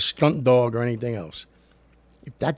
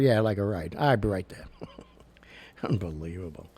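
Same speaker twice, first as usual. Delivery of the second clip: ordinary talk, nothing marked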